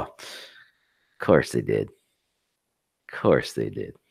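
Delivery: very low, slow, soft drop; deadpan disdain. Real, not acted. Reacting to a company's complaint, deadpan and deep. Wry repeated reaction to headline.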